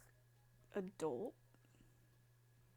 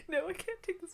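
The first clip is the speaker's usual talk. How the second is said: laughing; 9 semitones higher, 13 dB louder than usual. Real, not acted. Cracking up at game voice.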